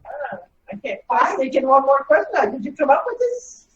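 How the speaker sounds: ordinary talk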